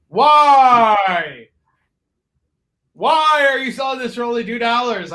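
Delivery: hammed-up pleading holler; mock customer outcry. Imagined buyer demanding answers from the seller, mock outcry. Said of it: "you're gonna be like".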